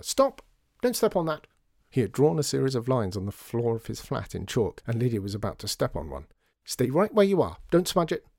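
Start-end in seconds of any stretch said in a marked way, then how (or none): 0.0-1.5 very high, sudden warning cry; alarmed shout. A narrator's reading. George Joseph protecting chalk lines from Lydia, shouting. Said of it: "actually shouted at her". 6.6-8.2 higher, anxious raised voice; urgent warning. A narrator's reading. George Joseph guarding chalk lines from Lydia, urgent warning.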